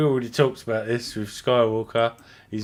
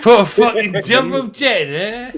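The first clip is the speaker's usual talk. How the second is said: higher, louder hammed-up British accent; 10 semitones higher, 7 dB louder than usual. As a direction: echo the mock British line, pitched up and punchy. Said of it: "perfect my British accent".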